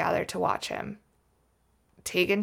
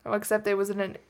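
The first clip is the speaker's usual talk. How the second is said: usual speaking voice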